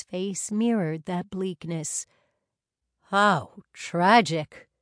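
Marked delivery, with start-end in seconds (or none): 3.1-4.7 loud, slow dismay; exclaiming. Acted. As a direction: voice the shock slowly and loud. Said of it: "he exclaimed".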